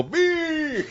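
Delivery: high held "beee" squeal; imitated squeal. Host mimicking a wrestler pumping fists, high squeal. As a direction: imitate a wrestler's silly high-pitched reaction. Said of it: "and go".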